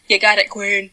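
lightly voiced bartender catchphrase; a hint of an obliging bartender, amused; Craig the bartender. Bartender answering a drink order, lightly impersonated. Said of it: "that's Craig, the bartender at Five".

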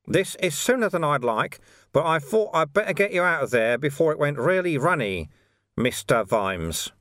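high, lilting character voice; unruffled monk mentor. Acted. Invisible friend urging Vimes to sit up, unruffled and high.

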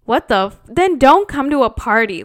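loud, fed-up outburst; exasperated outburst. Real, not acted. Host fed up with rude guests, loud exasperation. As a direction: blow up in disbelief. Outrage at refusing the game.

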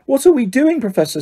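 put-on a nervous boy asking his stern teacher; nervously. A narrator's reading. Ron nervously asks McGonagall about detention. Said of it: "said Ron nervously".

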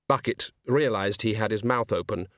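ordinary delivery, nothing marked

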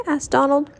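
ordinary delivery

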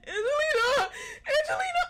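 terrifying distressed tone. Retold or imitated. Retelling calling Angelina for help, imitated terrified wail. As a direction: wail the name as if panicking. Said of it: "it was such a terrifying tone".

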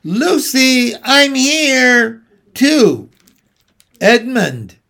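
loud, high-pitched calling out; shouting. A narrator's reading. Edmund shouting for Lucy in the wood, shouting. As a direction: call out for someone lost in the woods. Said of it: "he shouted".

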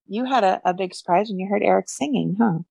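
usual speaking voice

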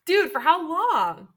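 high, loud, drawn-out disbelief; astonished. Acted. Friend learns of a secret romance, astonished. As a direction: stretch the question out in shock.

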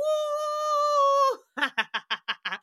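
high, loud gleeful cackle; cackling laugh. Real, not acted. Watching the imagined horror ending, loud gleeful cackle. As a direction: burst into a shrieking laugh.

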